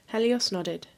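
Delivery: usual speaking voice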